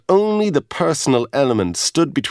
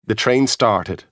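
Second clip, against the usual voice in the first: usual speaking voice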